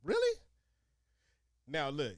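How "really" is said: high shocked question; surprised. Real, not acted. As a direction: gasp it in disbelief. Reacting to razor blades answer.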